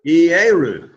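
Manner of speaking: "Ieru" with its two opening vowels both sounded; model pronunciation. Shown as a demonstration. Teacher modelling the Greek word for temple, "Ieru" pronounced carefully. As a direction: say "Ieru" clearly, ending on the diphthong, pitch falling. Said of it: "you've got two vowels together".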